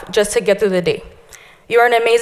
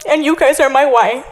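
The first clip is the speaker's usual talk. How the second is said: crying through words; 5 semitones higher than usual. Real, not acted. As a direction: voice breaking, pitched up high with tears. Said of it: "Sorry, I'm gonna cry".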